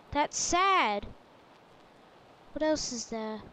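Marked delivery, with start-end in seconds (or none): 0.1-1.1 loud, emphatic playful reproach; mock reproach. Player addressing Mojang about the joke, loud mock reproach. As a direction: throw it loudly at the game makers.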